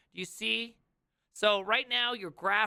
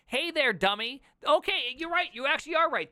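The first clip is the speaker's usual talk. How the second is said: salesman; 7 semitones higher than usual. Pretend. Mock salesman voice.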